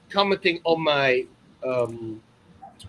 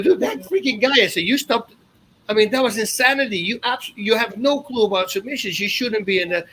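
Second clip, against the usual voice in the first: heated, higher-pitched protest; exasperated rebuke, voice raised; retold telling-off; 6 semitones higher than usual. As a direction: scold an official in retold outrage. Retold angry telling-off of an official.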